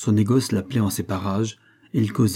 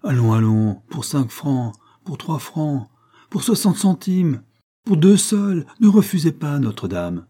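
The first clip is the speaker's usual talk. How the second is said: climbing market-trader call, higher with each price; hawker's cry; 5 semitones higher, 3 dB louder than usual. A narrator's reading. Merchants crying dropping prices to pilgrims, rising hawker's call. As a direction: call out bargains, pitch rising to a peak. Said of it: "Les marchands criaient".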